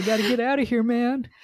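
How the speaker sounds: high, panicked mock cry; panicky. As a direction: play a spooked guy bolting off. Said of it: "He's like".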